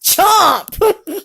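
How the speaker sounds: high, excited playful shout; tiger chomp. Pretend. Parent playing tiger for child, high shouted chomp. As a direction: burst out with a big playful chomp. What's that say?.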